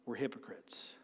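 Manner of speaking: whispered conviction; hushed whisper. Real, not acted. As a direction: drop to a near whisper. Preacher drops to a hush.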